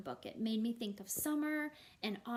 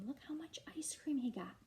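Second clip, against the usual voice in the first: whisper; 4 semitones higher than usual. Real, not acted. Teacher's aside whispered to kids.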